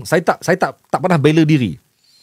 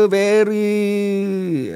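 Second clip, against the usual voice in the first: higher, long drawn-out stuck word; real stutter block; 6 semitones higher than usual. Real, not acted. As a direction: stretch the word, stuck and searching for the next. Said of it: "Memang gagap. Memang tak boleh".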